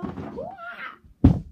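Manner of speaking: high loud hoo-ah battle cry; martial arts yell. Acted. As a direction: kick it with a sharp hoo-ah. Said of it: "then you go".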